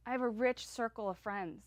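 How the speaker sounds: ordinary delivery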